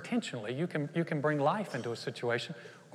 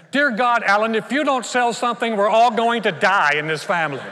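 loud, high-pitched wail of doom; hammed-up despairing wife; mock panic; 6 semitones higher, 10 dB louder than usual. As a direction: plead loudly as if all is lost. Imagined panicking wife, against encouraging one.

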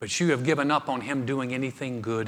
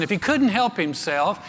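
normal talking voice

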